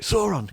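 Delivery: Sauron called out high as SORE-on; naive reader's pronunciation. Acted. Kid reading the book, high naive SORE-on for Sauron. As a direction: say Sauron as "SORE-on", pitched right up. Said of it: "when you just read it as a kid".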